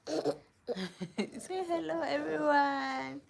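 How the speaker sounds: high, drawn-out sing-song greeting. Real, not acted. Mother prompts her baby to greet viewers, high and slow.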